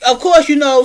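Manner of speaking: ordinary talk, nothing marked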